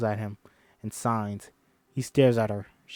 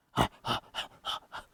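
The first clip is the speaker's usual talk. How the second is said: whispered panting; 8 dB quieter than usual. Acted.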